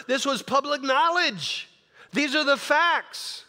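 high, forceful preacher's retelling; acting out an impassioned defence; emphatic insistence. Acts out Paul's insistent defence.

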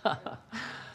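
high amused chuckle; hearty laugh. Acted. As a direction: laugh it off warmly. Amused at reporter's speech.

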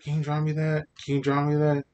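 high, loud mock pleading from classmates; eager pestering. Classmates eagerly asking for drawings.

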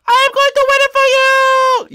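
very high, loud, drawn-out squeal; squeaky determined bunny. Acted. Bunny promising its backer a win, loud squeaky shout. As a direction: squeal it out slowly and loudly.

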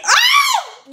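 sudden high shriek of shock. Real, not acted. Reacting to the clip with a shocked shriek. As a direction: yelp at the mom's question.

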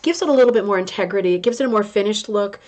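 ordinary delivery

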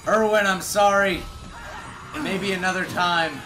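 normal talking voice

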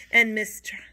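ordinary delivery, nothing marked

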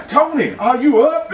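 teasing impression of a wake-up call; imitating stepfather's call. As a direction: holler it slowly, mimicking an early caller. Stepfather calling at the door.

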